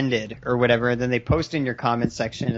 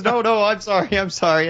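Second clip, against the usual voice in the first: mock apology back; 5 semitones higher than usual. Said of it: "And then he goes".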